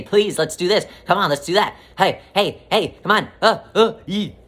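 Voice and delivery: high, loud pestering plea; nagging scream. Retold or imitated. Inner voice nagging for attention, high and loud. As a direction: whine and pester like a needy child. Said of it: "trying to scream at you".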